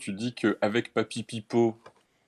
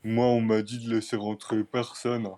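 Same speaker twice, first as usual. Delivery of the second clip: dim bouncer voice. Pretend. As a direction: play a thick-headed bouncer. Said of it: "Il fait".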